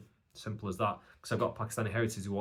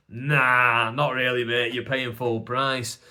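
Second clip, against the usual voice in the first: cheeky refusal; 5 dB louder, slower than usual. Retold or imitated. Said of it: "And then I go".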